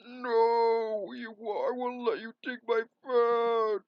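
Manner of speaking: high, loud, slow outraged cry; shrill villain shout. Acted. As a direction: shriek it in villain fury. Villain shrieking at hero.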